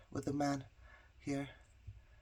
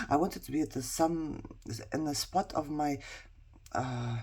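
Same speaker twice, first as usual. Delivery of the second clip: ordinary talk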